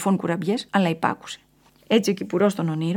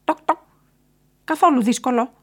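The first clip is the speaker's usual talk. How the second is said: high, pecking bird voice; high, louder woodpecker; woodpecker character; 7 semitones higher, 5 dB louder than usual. A narrator's reading. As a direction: tap out the knocks, then chirp the reply.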